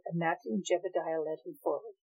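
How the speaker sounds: ordinary delivery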